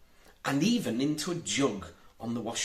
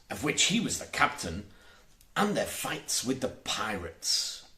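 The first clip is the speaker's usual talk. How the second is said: plain reading voice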